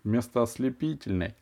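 usual speaking voice

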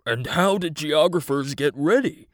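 put-on lion voice, a touch of timidity. A narrator's reading. Lion asks from his corner, in a mildly timid character voice. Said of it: "asked in a timid voice from his corner".

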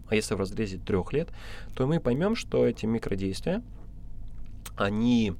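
normal talking voice

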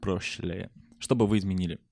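ordinary delivery

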